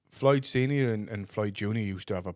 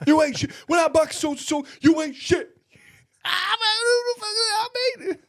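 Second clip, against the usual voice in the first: imitating a furious yelling father, high and loud; mock furious shouting old boxer; angry shout; 5 dB louder than usual. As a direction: scream it like a gym row. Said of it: "He goes".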